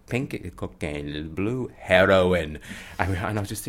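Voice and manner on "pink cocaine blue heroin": very slow, deliberate official English; slow halting announcement; officer's procedure. Retold or imitated. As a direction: spell it out like a careful officer. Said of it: "He went".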